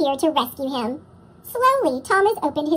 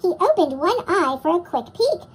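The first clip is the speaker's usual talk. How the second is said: plain reading voice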